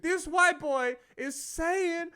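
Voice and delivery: very high, loud incredulous imitation; mock outrage. Front-row man calls out the new comic, high mock outrage. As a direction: call it out slowly in hammed-up disbelief. Outraged audience member retold.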